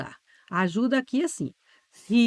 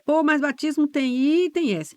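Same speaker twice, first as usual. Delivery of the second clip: lightly voiced student objection, pitched up; objecting student; 7 semitones higher, 4 dB louder than usual. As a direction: raise the pitch like a pupil challenging the teacher. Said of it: "Você vai dizer".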